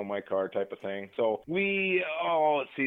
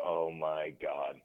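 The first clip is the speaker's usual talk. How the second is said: retold dread; 7 semitones lower, 7 dB quieter, much slower than usual.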